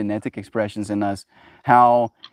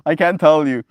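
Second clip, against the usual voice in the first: normal talking voice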